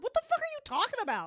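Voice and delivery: fast, high-pitched bafflement; mock incredulous outburst; David. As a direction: play totally confused, rushing the question. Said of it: "And David's just like".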